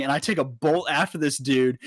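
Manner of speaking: normal talking voice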